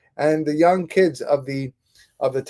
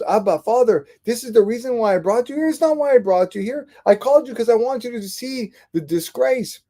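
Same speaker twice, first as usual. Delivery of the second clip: much higher, pleading in disbelief; son's exasperated higher cry to his father; disbelieving protest; 9 semitones higher than usual. Retold or imitated. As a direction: plead with your father, stunned he missed the point. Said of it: "his son couldn't believe it".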